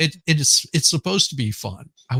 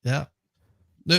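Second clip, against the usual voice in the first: usual speaking voice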